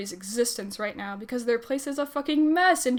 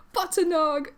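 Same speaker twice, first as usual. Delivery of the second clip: high, excited exclamation; delighted cry; 6 semitones higher, 3 dB louder, slower than usual. A narrator's reading. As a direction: cry the pet's name back in delight. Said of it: "Gavin cries".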